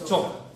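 loud, crisp Cho for the class to copy; teacher leads the class: loud clear Cho; Cho for repeating. Shown as a demonstration. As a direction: call out Cho as a model. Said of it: "Repitan conmigo".

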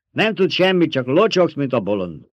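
high, loud dismissal, falling at the end; scornful rebuke. A narrator's reading. Alia calling the old woman a fool, scornful.

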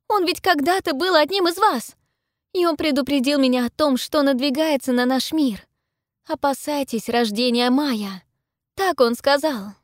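high, loud, impassioned warning; urgent insistence. A narrator's reading. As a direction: press on so they believe you. Heroine pleading to be believed.

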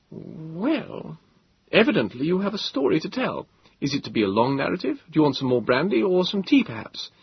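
a touch of wry modesty; young Moses. Acted. Moses voiced by narrator.

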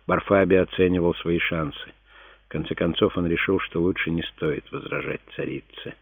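plain reading voice

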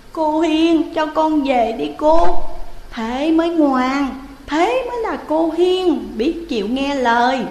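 put-on high, sweet wheedling child voice; sweet coaxing. Young Thẩm sweetly wheedling ghost Hiền. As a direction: sweet-talk her into letting you go. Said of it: "Thẩm ngọt ngào nói".

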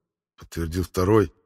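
ordinary delivery, nothing marked

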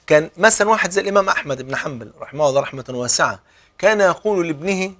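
normal talking voice

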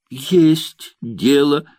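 slow, loud, emphatic retort; defiant outburst. Acted. Jealous Nina defies Morozov, loud and slow. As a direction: stamp the words out in defiance. She stamps her foot in jealousy.

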